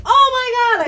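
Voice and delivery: high, loud oh my God; sudden realisation. Retold or imitated. Imitating Jessie finally getting the joke, loudly surprised. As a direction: burst out as it finally clicks. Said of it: "she's like".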